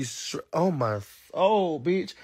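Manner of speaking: ordinary delivery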